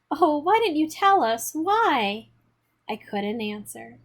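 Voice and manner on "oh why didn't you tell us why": a pleading mother, higher, a touch of tears; quavering plea. A narrator's reading. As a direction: ask it as a worried mother near tears. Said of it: "say in a quavering voice".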